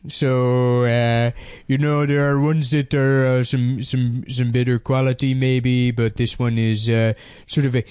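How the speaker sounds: put-on hesitant nerd in a made-up accent; mock a video host with a weird invented accent; odd accent, fumbling through the presentation; That Sodding Gamer. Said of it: "this weird accent he made up".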